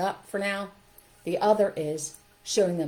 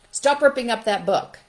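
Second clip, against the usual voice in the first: loud, higher reprimand; stern command; 5 semitones higher, 9 dB louder than usual. Acted. Teacher to child tearing a book, sternly scolding. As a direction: snap at a child to stop.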